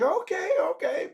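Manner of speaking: high, light okay okay; women agreeing. Retold or imitated.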